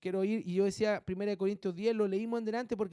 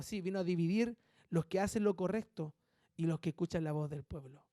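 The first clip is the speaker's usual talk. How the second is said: ordinary delivery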